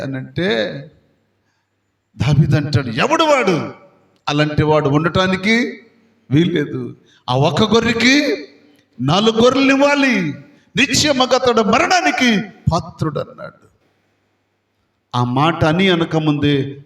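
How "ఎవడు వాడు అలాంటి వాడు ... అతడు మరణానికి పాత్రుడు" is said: angry king's verdict. Acted. David angrily condemns the rich man.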